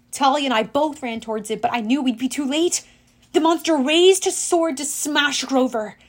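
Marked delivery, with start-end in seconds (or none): none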